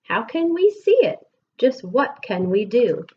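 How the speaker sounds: ordinary talk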